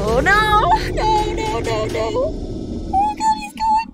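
high, panicked shrieking. Real, not acted. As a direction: freak out in real panic. Jumpscare panic in horror game.

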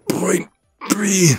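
high, squeezed words mid-lift; straining under weight. Acted. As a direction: force the words out while pushing. Still lifting while talking.

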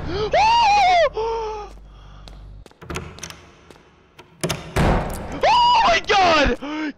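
high, loud, stretched-out cry of fright; panicked shout. Real, not acted. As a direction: shout in real panic, dragging the words. Jump scare, screams.

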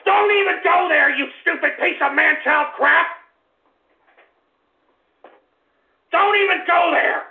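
high, loud furious shout; furious yelling. Acted. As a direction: scream it down the line at him. Host yelling at prank caller.

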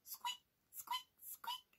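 tiny, very high-pitched mouse squeaks. Shown as a demonstration. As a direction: squeak very softly like a little mouse. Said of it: "he sounds a little bit like this".

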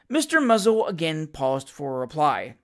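ordinary delivery

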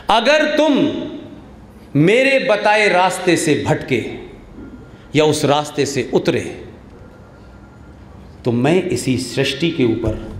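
low, slow, grave voice of God; solemn divine warning. Retold or imitated. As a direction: speak as God warning his people. Quoting Allah's warning from Quran. (धीमी, गंभीर, ईश्वरीय चेतावनी की आवाज़)

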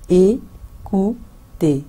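écouter stretched very slowly; drawn-out demo. Shown as a demonstration. Teacher slowly drawing out écouter for learners. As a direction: lengthen every sound of écouter. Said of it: "मैं फिर से repeat करूँगी".

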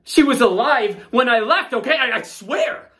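high, loud, desperate denial; panicked pleading. Acted. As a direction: beg them to believe you. Suspect pleading innocence, panicking.

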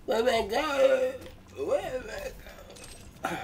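slow, high mocking echo. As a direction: drag it out high and silly. Mocking partner's question.

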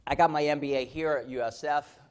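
ordinary talk

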